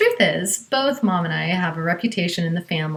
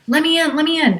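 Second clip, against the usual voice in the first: urgent plea; 9 semitones higher, faster than usual. Retold or imitated. Mom frantic at the door.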